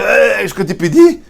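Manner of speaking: high, loud, cheeky complaint; mocking quote of the shout. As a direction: toss back his rude demand. Quoting sage's cheeky complaint.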